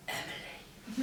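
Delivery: put-on barely audible flat mumble of a sulky teen. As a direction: mumble it, unwilling to engage. Sulky teen answers pilot.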